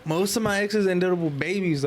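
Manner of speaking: normal talking voice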